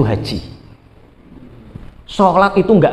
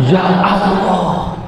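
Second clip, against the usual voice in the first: long drawn-out exclamation of dismay; mock dismay; 2 semitones higher, much slower than usual. Pilgrim asked to pay a tout at the Kaaba, dismayed. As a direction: stretch it out like a pilgrim realizing he's been had. Pilgrim discovers he was scalped.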